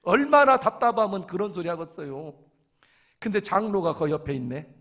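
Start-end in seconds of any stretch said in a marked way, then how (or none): none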